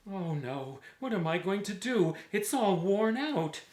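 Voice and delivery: tailor despairing. Acted. Tailor laments his worn-out vest, in mock dismay. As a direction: moan about the worn-out vest.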